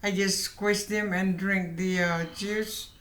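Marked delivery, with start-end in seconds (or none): none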